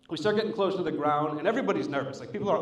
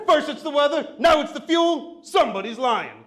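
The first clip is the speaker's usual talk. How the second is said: high, loud mock accusation; indignant heckler; 9 semitones higher, 5 dB louder than usual. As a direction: mimic an outraged passenger calling out. Suspicious girl keeps calling out.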